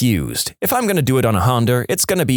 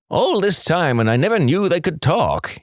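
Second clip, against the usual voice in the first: amazed farmer; 7 semitones higher than usual. Acted.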